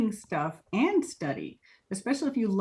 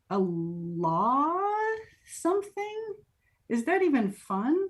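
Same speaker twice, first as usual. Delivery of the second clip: retold doubtful questioning; 6 semitones higher, slower than usual. Acting out her doubtful reaction.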